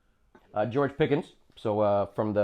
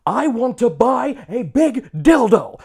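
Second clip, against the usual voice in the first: loud, high, slow proclamation; mock scream; 10 semitones higher, 9 dB louder, much slower than usual. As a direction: announce it to the whole room. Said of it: "He just keeps screaming it".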